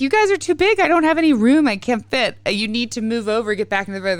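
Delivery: high, loud plea to her sons to shift over; cram-packed mother begging for room; exasperated mom. Retold or imitated. Mother to sons crowding her bed, exasperated high loud plea.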